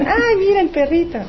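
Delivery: put-on high, delighted little-kid voice; excited child. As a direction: squeal over the puppy like a small child. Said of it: "Vas a".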